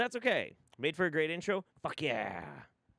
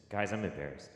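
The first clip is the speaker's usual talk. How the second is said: ordinary delivery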